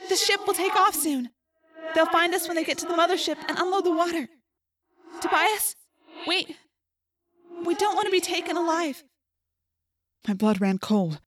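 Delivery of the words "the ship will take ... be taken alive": put-on high, distant girl, pleading gravely; trapped Rachel. A narrator's reading. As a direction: plead in a high voice, resigned to the worst. Plays trapped Rachel in narration.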